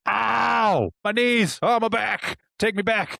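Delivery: high whiny groaning complaint; old man complaining. Pretend. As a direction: groan like your body aches all over. Waking up in older body.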